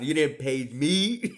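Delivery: slow, laughing through the words. Retold or imitated. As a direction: crack up while repeating it. Repeating it, laughing.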